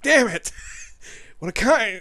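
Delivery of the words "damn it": hammed-up loud curse, pitched high; mock frustration. Gamer parodying a movie breakup, mock loud curse. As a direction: spit it out like a sulking movie hero. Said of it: "It's like".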